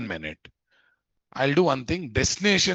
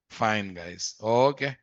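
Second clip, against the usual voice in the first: usual speaking voice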